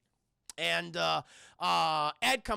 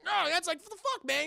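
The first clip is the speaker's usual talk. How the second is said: high-pitched mock outrage; imitated affronted yelp; retold indignant protest; 9 semitones higher than usual. Retold scuffle over phone.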